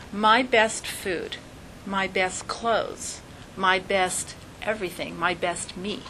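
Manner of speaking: MY best stressed on each item, slowing and fading; building list of "my best", dropping to a hush; slow emphasis on my best. Shown as a demonstration. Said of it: "slow emphatic list".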